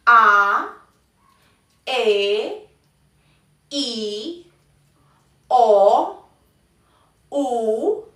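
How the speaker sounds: "A, E, I, O, U" each drawn out, climbing at the end; questioning rise. Shown as a demonstration. Teacher demonstrating Mandarin vowels, rising tone on each. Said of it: "utilizamos la pregunta de".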